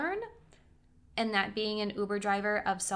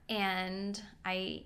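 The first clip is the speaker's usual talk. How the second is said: usual speaking voice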